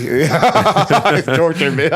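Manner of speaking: laughing through the words, slow. Real, not acted. Guest under heat lamps, laughing. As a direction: laugh it out, hot and tired.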